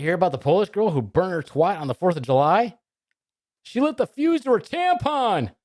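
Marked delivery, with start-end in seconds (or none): 3.6-5.5 high, slow joke punchline; hammed-up high delivery; high-pitched punchline. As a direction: push the punchline way up high, drawn out.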